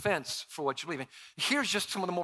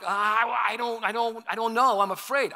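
higher, hesitant, mock-nervous stammer; imitating fearful listener; 7 semitones higher, 7 dB louder than usual. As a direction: play the timid churchgoer, lightly. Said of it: "I know some of you are like".

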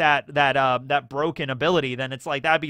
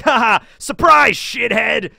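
shouted, much higher, gloating clown; Buggy taunt; 8 semitones higher, 9 dB louder, slower than usual. Retold or imitated. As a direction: yell it in his face, slowly and gleefully.

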